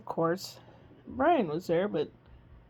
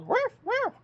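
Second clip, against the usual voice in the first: much higher toy dog barks; woof woof. Acted. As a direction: squeak out the toy's woof. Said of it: "it goes".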